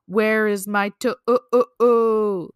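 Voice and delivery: loud, slow, pulsing moan; hammed-up spooky groan; groaning ghost. A narrator's reading. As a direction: moan it with a long broken last vowel. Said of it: "the voice groaned".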